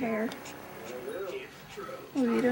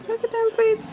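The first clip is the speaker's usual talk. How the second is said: high coo; 10 semitones higher than usual. Real, not acted. Higher coo at baby.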